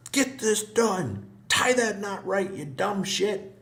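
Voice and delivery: high, slow, loud mock barking orders; imitating a drill sergeant. Veteran recalling his drill sergeant yelling, loud slow mock bark. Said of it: "drill sergeant who would just freaking yelling and screaming".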